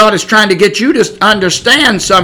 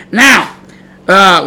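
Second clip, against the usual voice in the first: ordinary talk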